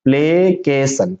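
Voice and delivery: slow, drawn-out "प्लेकेशन"; syllable by syllable. Shown as a demonstration. Teacher answering own question, slowly in syllables. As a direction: separate "प्लेकेशन" into play, kay, san. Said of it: "क्या पढ़ेंगे".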